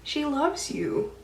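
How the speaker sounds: put-on calm her down like a frightened child; reassuring mom. Mom wiping tears, reassuring.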